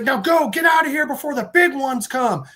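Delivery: high, forceful acted order; urgent warning. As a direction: call out to send them running. Stranger orders kids to flee.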